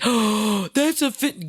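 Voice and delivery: high, mocking offended voice; mocking a sensitive person. Mocking touchy critics in a high, offended voice. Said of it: "somebody being like".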